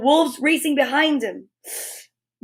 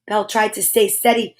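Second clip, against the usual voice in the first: ordinary delivery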